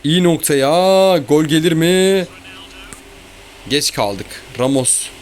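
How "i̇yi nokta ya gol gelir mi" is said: louder and higher, drawn-out cheer at the shot; urging the ball in; excited yell at a good chance; excited hope. Real, not acted. Shot on goal during match.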